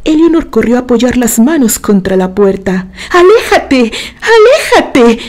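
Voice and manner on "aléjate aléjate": high, desperate cry, dropping on the repeat; frantic yell to drive something away; frantic shriek. A narrator's reading. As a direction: cry out beside yourself. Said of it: "chilló fuera de sí".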